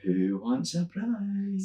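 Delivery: high, soft, very slow put-on voice; drawn out, limp and feeble; English entertainer. Friend mocks the English host's feeble prize call, very slow. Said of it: "Instead they're getting".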